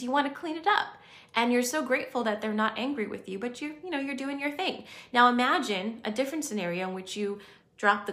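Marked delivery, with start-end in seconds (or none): none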